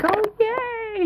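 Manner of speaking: very high, sing-song cheer; mom cheering. Retold or imitated. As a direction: cheer back at her toddler. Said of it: "So I'm like".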